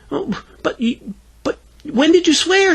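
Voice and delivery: put-on high, halting child voice; falter, then ask high-pitched; puzzled little girl.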